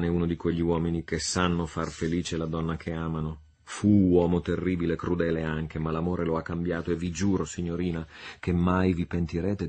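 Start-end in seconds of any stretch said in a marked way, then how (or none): none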